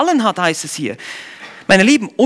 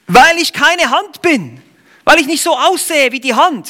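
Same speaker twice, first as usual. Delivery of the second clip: voicing the foot; 8 semitones higher, 4 dB louder than usual. Fuß speaks in the parable.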